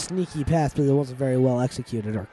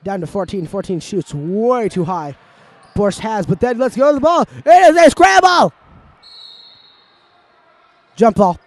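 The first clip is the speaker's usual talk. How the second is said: lively live commentary, building excitement; play-by-play; 5 semitones higher, 11 dB louder than usual. Acted. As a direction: call the game like a sports announcer. Amateur play-by-play of a game.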